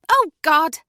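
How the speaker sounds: loud, high Oh God; sudden dismay. Acted. Julie realising she's too pushy, loud dismay. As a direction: catch yourself mid-whirlwind.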